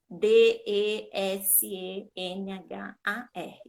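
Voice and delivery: letter by letter, slowly; each letter spaced apart; slow spelling. Shown as a demonstration. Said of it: "Aí ele vai falar, olhando pro papel".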